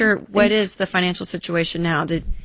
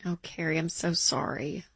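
much softer, gentle condolence; soft sympathy; 9 dB quieter than usual. Real, not acted. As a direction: hush down for a grieving caller. Condolence to a new widow.

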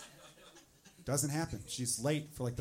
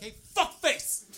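yelled much higher and louder, slowed at the start; angry yell; 11 semitones higher, 10 dB louder than usual. Retold or imitated. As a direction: scream out the car window at him. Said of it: "I would have rolled down the window and be like".

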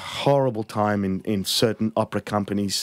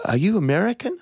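curious concertmaster; 9 semitones higher than usual. Retold or imitated. Concertmaster questioning the conductor.

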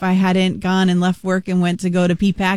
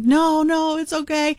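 higher, choked-up mock reassurance; teary fan; 10 semitones higher than usual. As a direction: imitate a fan crying but insisting she's fine. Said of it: "starts absolutely crying".

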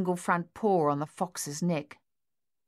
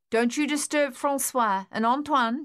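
higher, carrying call after a dog; 7 semitones higher, 3 dB louder than usual. Acted. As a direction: call it out across the yard. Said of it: "she called after the dog".

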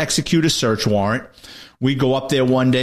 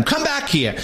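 retold shout; 11 semitones higher than usual. Detective after a man fleeing down the hallway, mild retold shout. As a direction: call after him in a raised pitch. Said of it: "I shouted at him".

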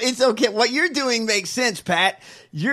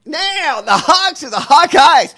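mock outraged shouting; 6 semitones higher, 9 dB louder than usual. Real, not acted.

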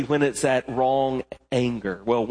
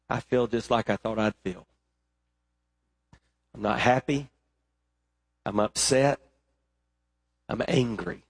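sulky complaint; 3 semitones lower, 4 dB quieter than usual. Acted. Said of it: "Jonah's thought process was simple".

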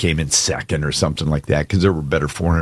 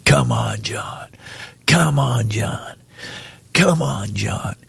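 ordinary talk

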